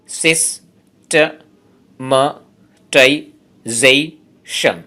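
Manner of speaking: সিস্টেমাটাইজেশন pulled apart into slow, separate syllables; teacher splitting সিস্টেমাটাইজেশন into syllables. Shown as a demonstration. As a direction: count out every syllable of সিস্টেমাটাইজেশন. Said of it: "এই ওয়ার্ডটাতে আছে ছয়টি syllable".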